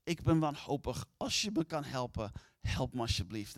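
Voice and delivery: hushed begging, close to the ear; mild retold whisper of a desperate man; pleading whisper. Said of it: "En fluisterde in zijn oren".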